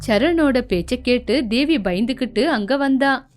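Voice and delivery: usual speaking voice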